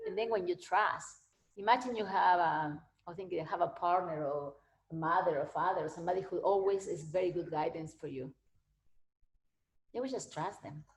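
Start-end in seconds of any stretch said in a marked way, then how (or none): none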